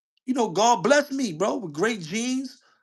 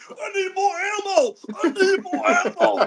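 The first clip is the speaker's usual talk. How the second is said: high, laughing soldier cry for ammo; hammed-up panicked soldier, laughing; desperate soldier; 10 semitones higher, slower than usual. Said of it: "I'ma be like this".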